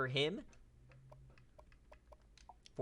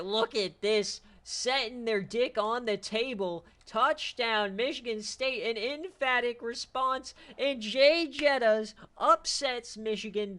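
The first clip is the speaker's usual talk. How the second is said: hyped call; 7 semitones higher, 7 dB louder than usual. Real, not acted. Hyped call of winning touchdown.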